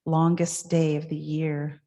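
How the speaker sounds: normal talking voice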